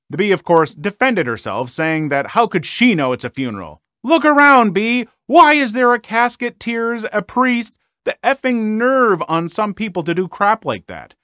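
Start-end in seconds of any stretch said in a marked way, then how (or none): 4.0-7.8 much higher, louder incredulous yell; retold yell. Mourner yelling at the woman who disrupted the funeral. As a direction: yell it at her in disbelief.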